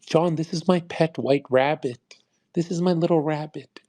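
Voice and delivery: high, prissy mock of a pet owner. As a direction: sing it up like a doting rabbit owner.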